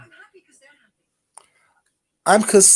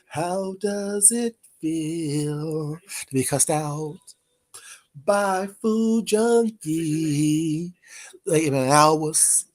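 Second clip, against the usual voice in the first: slow mocking taunt; 5 semitones lower, 5 dB quieter, much slower than usual. Real, not acted. Taunting chatter.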